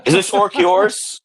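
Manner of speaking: soldier questioning. Acted. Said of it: "This soldier leans around".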